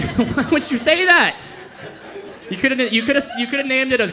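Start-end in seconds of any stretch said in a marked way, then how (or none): 0.0-1.4 high, slow, incredulous protest; mock outrage. Comedian scolding whoever named chocolate labs, mock outrage. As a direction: demand an explanation in comic disbelief.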